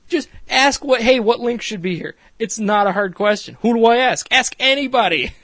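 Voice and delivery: high-pitched exasperated lecture; Kristen yelling. Retold or imitated. Boss telling worker how to ask, exasperated scolding. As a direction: spell it out in loud exasperation. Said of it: "Kristen rightfully yells at her".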